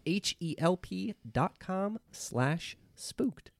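slow, careful spelling of the web address; slow spelled out. Shown as a demonstration. Ad host spelling the URL for listeners, slow and careful. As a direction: spell it out slowly, letter by letter. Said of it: "spelling out ad URL".

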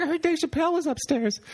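put-on high squeaky girlish voice; girlfriend voice. Girlfriend explaining to the comic, in a high girlish voice. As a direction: pitch way up like the excited young woman. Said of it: "I was like".

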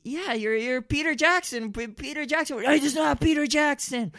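high, loud frantic back-and-forth; frantic confrontation. Acted. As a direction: argue both sides in a frenzy. Confrontation, acting both sides.

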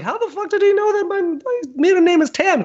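high, astonished mom voice; mom's disbelief. Retold or imitated. Mom stunned the monk knew her maiden name, high astonished squeak. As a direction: squeak it out in shock. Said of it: "my mom was like".

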